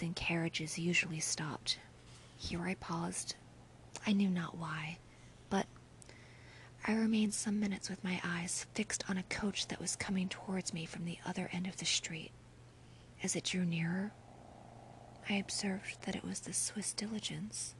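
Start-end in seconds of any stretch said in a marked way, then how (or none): none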